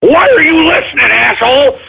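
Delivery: high, shouted cry of fury; hammed-up wounded yell; tearful rage. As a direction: scream back at the haters. Raging at critic viewer.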